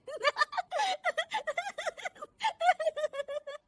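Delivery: long, high-pitched laughing fit; hearty laughter. Acted. Daughter-in-law watching a TV serial, laughing hard. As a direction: laugh uproariously at the television. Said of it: "बहू के जोर जोर से हंसने की आवाज".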